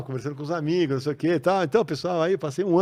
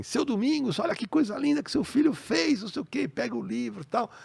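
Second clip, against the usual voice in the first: high, gentle coaxing voice, much higher; sweetly persuading an old man, pitched way up; Maurício to my father; 11 semitones higher than usual. Retold or imitated. Maurício gently praising the son to his father, high and coaxing. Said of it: "ele falou assim".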